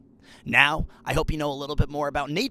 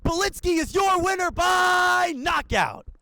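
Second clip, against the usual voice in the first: much higher, stretched-out yelled finish; knockout call. Acted. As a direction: roar the knockout like the crowd just erupted.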